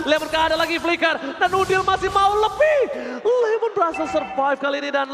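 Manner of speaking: loud, drawn-out shouted hype call; fighting game announcer. Real, not acted. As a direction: roar the outplay like an announcer. Hype esports play-by-play.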